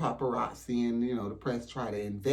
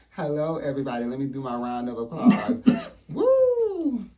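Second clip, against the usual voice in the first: hammed-up host welcome, louder and higher; talk show host; 3 semitones higher, 5 dB louder than usual. Host greeting her pretend studio audience, hammed-up and loud. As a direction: open your pretend show like a big TV host.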